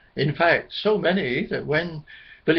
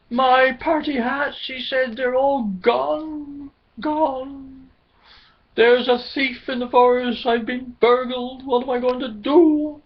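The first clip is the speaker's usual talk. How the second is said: upset wail; 8 semitones higher, 4 dB louder than usual. Acted. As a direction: bewail losing every hat. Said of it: "Billy was ever so upset".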